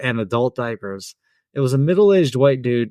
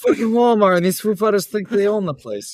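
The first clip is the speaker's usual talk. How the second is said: higher-pitched, botched actor impression gone British; British-sounding impression; 7 semitones higher than usual. Shown as a demonstration. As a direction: rant in an accidental British accent. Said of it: "That sounded British".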